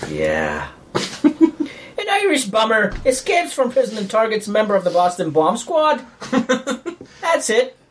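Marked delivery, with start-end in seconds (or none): 1.2-7.8 over-the-top stage Irish, laughing through it; hammy Irish accent. Pretend. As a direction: ham up a leprechaun brogue. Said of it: "of Irish accents".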